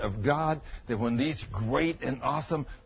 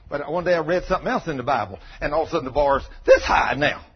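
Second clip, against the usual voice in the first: ordinary talk, nothing marked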